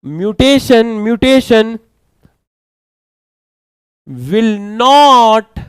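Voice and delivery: every word spaced out slowly for notes; slow dictation. Shown as a demonstration. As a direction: dictate slowly so students can write. (धीरे-धीरे लिखवाते हुए)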